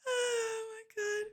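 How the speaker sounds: high, slow, breathless with laughter; crying laughing. Real, not acted. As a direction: wheeze it out, weak from laughing. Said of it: "Oh God, I'm crying".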